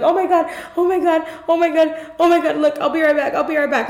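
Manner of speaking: high, fast imitation of someone panicking; panicked freak-out. Patient imitating her doctor freaking out in the room, mock panic. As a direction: rattle it off in a mock fluster. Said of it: "she started having a mental breakdown".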